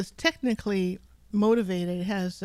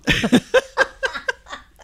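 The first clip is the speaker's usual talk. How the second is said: laughing; 9 semitones higher, 7 dB louder than usual. Real, not acted. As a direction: burst of high laughter. Laughing at captain joke.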